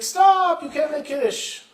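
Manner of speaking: very high yell of protest; urgent shout. Acted. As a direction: cry out loudly, then protest still high. Boy interrupts the blessing again.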